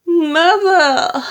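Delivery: high, loud, stretched-out wail. A narrator's reading. Daughter horrified at what mother drank, loud wail. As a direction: cry out in horror, drawn long. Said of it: "wailed Missus Cranky".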